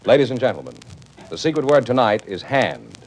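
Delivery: usual speaking voice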